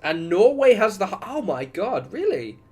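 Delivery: high, loud disbelief; real astonishment. Real, not acted. Streamer reading Norway's match figures, astonished. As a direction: blurt it out, incredulous.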